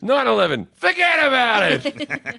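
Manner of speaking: lightly hammed New York shrug; New York accent. Mocking how a New Yorker would brush off nine eleven, New York accent. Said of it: "no one ever goes".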